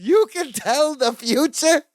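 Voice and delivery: high, slow, wonderstruck imitation; amazed Balki. Balki thinks Larry can tell the future, amazed. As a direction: marvel at it, high and drawn out.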